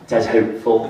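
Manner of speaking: normal talking voice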